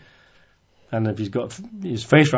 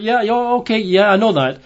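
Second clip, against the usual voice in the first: higher-pitched mock impatience, as Martha; brisk, dismissive agreement; 10 semitones higher than usual. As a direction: wave it off as already known, voice pitched up. Acts out Martha's quick agreement.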